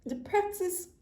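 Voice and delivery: struggling to regain composure after crying; emotional, tearful. Real, not acted. Reader feeling emotional for client, trying to resume tearfully. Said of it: "why am I feeling so emotional for you".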